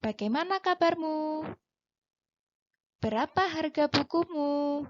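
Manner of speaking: "Bagaimana kabarmu", "Berapa harga bukumu" lifted high and held up; rising question intonation. Shown as a demonstration. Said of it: "menggunakan intonasi naik".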